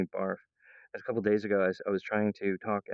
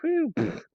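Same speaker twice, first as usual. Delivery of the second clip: very high mock exhale and pfft; squeaky dismissive puff; dismissive whew; 3 dB louder than usual. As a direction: blow it off like a bored zombie. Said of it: "they're all like".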